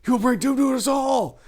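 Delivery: high, loud panicked warning; panicked shout. Acted. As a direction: cry out a warning of doom. Miago panicking at prince.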